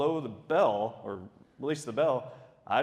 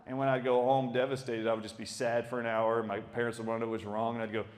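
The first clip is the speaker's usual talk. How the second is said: ordinary talk, nothing marked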